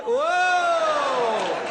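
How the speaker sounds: loud, high startled whoa; surprised whoa. Real, not acted. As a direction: jolt back in surprise. Startled by kiss.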